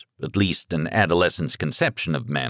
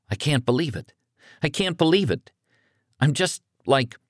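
put-on higher, disbelieving boy voice; young son, stunned and scared; panicked teenage son; 8 semitones higher than usual. A narrator's reading.

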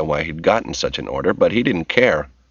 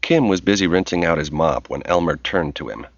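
ordinary delivery

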